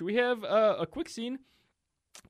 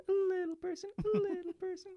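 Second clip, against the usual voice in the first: squeaky careful voice. Shown as a demonstration. Host jokingly keeping it PC, "a little person" in a high squeak. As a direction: pipe "a little person" up high as if tiptoeing around it. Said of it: "Just trying to keep it P C here".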